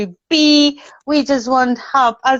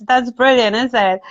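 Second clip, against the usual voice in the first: ordinary talk, nothing marked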